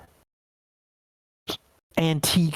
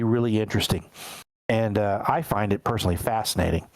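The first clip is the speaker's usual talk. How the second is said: ordinary delivery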